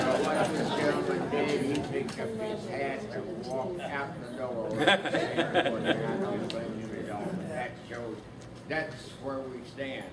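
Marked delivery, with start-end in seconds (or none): 4.8-7.3 slow words spoken through laughter; laughing. Real, not acted. Veteran still chuckling after his joke, slow laughing speech.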